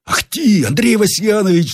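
high-pitched exclamation of a workman; high, excited outcry; crying out. A narrator's reading. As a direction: cry out in sudden alarm, pitch way up. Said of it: "вскричал мастеровой".

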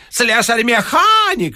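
high, slow sneering yell; a janitor jeering at the locksmith before a crowd; mocking shriek. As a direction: shriek the title as an insult. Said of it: "вскрикивал дворник".

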